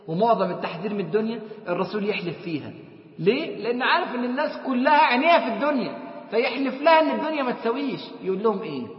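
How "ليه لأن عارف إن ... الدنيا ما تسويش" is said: louder, higher preaching voice, driving the point home; fiery preaching. Acted. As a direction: preach it out to the crowd with force. Preacher raises voice to congregation.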